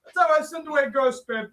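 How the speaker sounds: high, shouted diva line; Cher flourish. Acted. Cher boasting to the ghost. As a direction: finish with flair.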